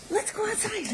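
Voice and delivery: soft unvoiced whisper to the dog; hushed whisper to a dog; whispered coax. Real, not acted. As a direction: whisper the invitation outside. Coaxing dog outside.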